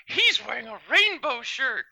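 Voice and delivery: put-on high-pitched toddler calling to mommy; excited little girl. Little girl tells her mommy about a stranger's shirt, high childlike voice. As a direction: imitate a small child piping up, drawn out. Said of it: "this little girl, she's, like, two or three".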